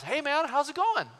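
high, sing-out hello; high-pitched mock-casual greeting; overfriendly greeting. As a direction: greet a stranger with put-on cheer. Acts his greeting to stranger.

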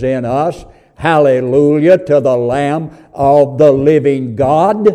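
rising, loud preacher cadence; fervent sermon delivery; preaching. Acted. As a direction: preach it out to the congregation. Preacher at full force.

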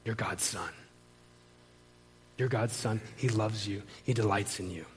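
low, soft and unhurried; tender reassurance. Retold or imitated. Counselor reassuring a doubting preacher, tender and soft. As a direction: let each affirmation settle gently.

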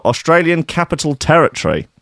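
ordinary delivery

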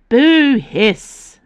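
high loud pantomime boo; mock boo. A narrator's reading. Tipsy lady booing Icaros in jest, high and loud. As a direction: boo him like a panto villain.

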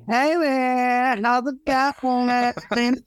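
mock friendly Netflix demon, high and drawn out. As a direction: play a demon greeting you like a buddy, pitched high. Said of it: "gonna be like".

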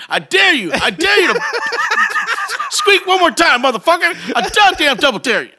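high, loud movie-gangster dare; Pulp Fiction threat. Retold or imitated. Threatening the squawking lovebird, hammed-up gangster dare. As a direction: menace the squawking bird like a film gangster. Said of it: "like I'm in Pulp Fiction".

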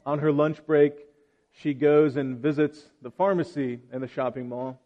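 ordinary talk, nothing marked

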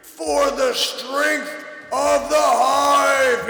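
booming high hive leader proclamation; slow, commanding, rising to a shout; telepathic voice. Acted. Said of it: "you hear this telepathic voice".

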